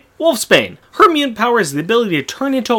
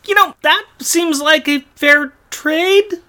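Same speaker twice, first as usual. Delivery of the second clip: sarcastic; 10 semitones higher, slower than usual. Pretend. Sarcastic quip, far voice.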